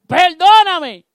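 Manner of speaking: a wail for mercy; wailing plea. Acted. Simi begging David after cursing him: wailing loud cry.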